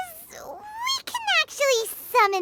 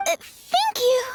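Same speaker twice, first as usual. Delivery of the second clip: higher, choked-up thank you on the verge of tears; tearful thanks; 7 semitones higher than usual. Acted. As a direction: thank them through rising tears. Moved thanks after the explanation.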